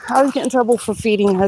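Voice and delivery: ordinary delivery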